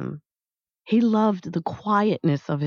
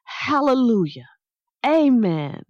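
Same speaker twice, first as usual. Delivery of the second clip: high, contained narrator's shout; raised-pitch church shout, falling away; 5 semitones higher than usual. A narrator's reading. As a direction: cry it out in praise, pitched up but not full volume. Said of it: "shouted at the top of his lungs".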